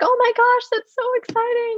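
high-pitched, gushing mock delight; squealy retold congratulations; excited mom. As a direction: imitate a thrilled mom on the phone. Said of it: "she was more excited than my dad".

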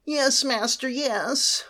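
high, eager servant voice; hunchback assistant. Acted. Slave answers master in dialogue.

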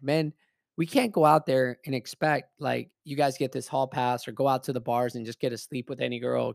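normal talking voice

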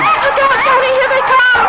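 high, loud cry; panicked warning. Acted. Wife warning her husband as the birds attack, panicked. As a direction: scream a warning.

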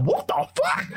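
very high-pitched, incredulous imitation; shocked outrage. A mum overhearing her son's secret in church, shocked. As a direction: act out a scandalised mother. Mum's shocked reaction, acted.

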